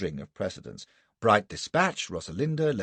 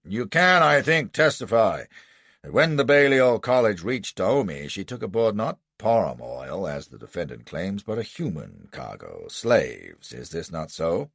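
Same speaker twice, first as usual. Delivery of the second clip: raised, forceful courtroom questioning; prosecuting lawyer; 5 dB louder than usual. Acted. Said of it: "the business he wanted to get his teeth into".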